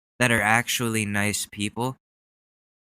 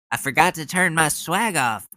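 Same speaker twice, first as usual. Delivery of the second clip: mock swaggering politician, higher and louder; fired-up Ted Cruz; 7 semitones higher, 3 dB louder than usual. Mocking a politician on stage, hammed-up swagger. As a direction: keep the hammed-up swagger going. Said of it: "I've never heard Ted Cruz get so fired up".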